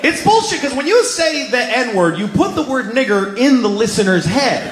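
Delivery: high-pitched, exasperated tirade; indignant rant. Acted. Comic scolding people who say a euphemism, high exasperated rant. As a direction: argue hotly, pitch riding well up.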